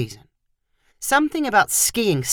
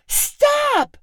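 scream. A narrator's reading. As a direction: shriek it, even more desperate. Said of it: "Chloe screamed".

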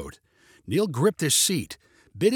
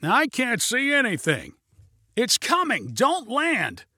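high, urgent warning; panicked shouting; 9 semitones higher, slower than usual. A narrator's reading. Passenger begs pilot to abort, panicked high shout.

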